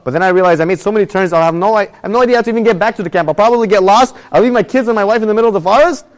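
a rising panic running through a worst-case list; retold panic. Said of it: "I'm getting very nervous".

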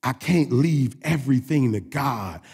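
slow it down so every word lands. Shown as a demonstration. Preacher repeating his point for the church, said slow. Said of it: "I'm gonna say it slow".

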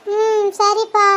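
put-on slow, drawn-out childish agreement; a sulky little girl giving in; grudging okay.

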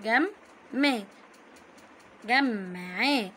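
"جمعاه" slowly drawn out as gam-ma-'a; doubled m demonstration. Shown as a demonstration. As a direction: hold the m in "جمعاه" before opening the vowel. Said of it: "نطقناها مرتين".

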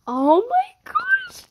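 very high, loud, slow shriek; jackpot squeal. Real, not acted. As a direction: shriek like you just won.

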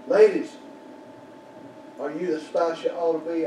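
usual speaking voice

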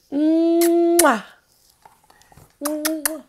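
smacking kiss sound. Shown as a demonstration. Teacher showing kids a kiss, smacking kiss. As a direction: blow a loud kiss. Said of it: "A kiss means I love you".

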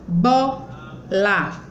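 "bola" broken into bo, la; syllable by syllable. Shown as a demonstration. Teacher showing two mouth openings, "bola" split in two. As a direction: say "bola" as two separate beats. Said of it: "quando eu abro a boca duas vezes".

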